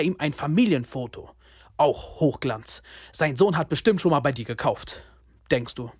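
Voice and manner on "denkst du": normal talking voice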